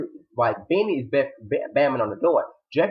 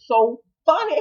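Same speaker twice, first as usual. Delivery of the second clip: excited high; 13 semitones higher, 5 dB louder than usual. Real, not acted. Laughing recount.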